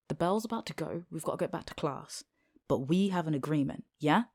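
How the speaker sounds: ordinary talk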